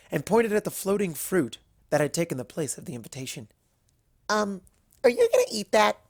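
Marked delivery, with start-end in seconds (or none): 4.3-6.0 high, hesitant little question; sheepish Pinkie. Acted. Pinkie eyes the apple, asking sheepishly in a high voice. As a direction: ask it a bit shyly.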